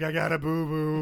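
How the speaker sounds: loud, dopey mock voice; dumb talk. Recapper imitating a girl knocked stupid, dopey voice. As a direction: play it brainless and loud. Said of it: "just saying dumb stuff".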